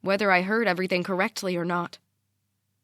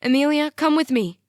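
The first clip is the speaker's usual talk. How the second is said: loud, high, urgent appeal; urgent plea; 7 semitones higher, 7 dB louder than usual. A narrator's reading. Mom reaches out to her daughter, urgent plea. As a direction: urge her to come, voice raised.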